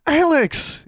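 put-on very high, loud girlish squeal; excited exclamation. A narrator's reading. Younger girl seeing her friend arrive, exclaiming. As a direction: cry out the name in delight. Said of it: "Innocence exclaimed".

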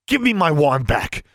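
angry hiss. A narrator's reading. Girl furiously demanding her wand from him, hissing angrily. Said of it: "she hissed".

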